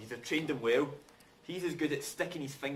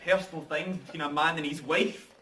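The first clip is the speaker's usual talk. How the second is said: ordinary talk, nothing marked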